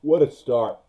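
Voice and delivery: words stretched through a real yawn. Real, not acted. Draft host yawning through his opener. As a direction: say it mid-yawn, mouth wide. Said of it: "Sorry for the yawn".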